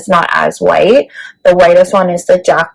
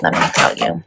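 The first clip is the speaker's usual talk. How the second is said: unvoiced aside, let me tell you whispered low; 14 semitones lower, 7 dB quieter, faster than usual. Real, not acted. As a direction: breathe let me tell you under your breath. Repeated catchphrase, first take.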